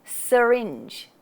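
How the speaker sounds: "Syringe" with a lazy schwa after the s; relaxed first vowel. Shown as a demonstration. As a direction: say "Syringe" as suh-ringe. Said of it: "the s".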